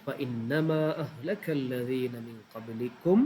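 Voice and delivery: slow Arabic recitation broken into short chunks; slow, cut phrase by phrase. Shown as a demonstration. Teacher demonstrating how to memorise a hadith in chunks. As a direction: recite it piece by piece, pausing between phrases. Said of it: "കണ്ടോ, അങ്ങനെ cut ചെയ്യുക".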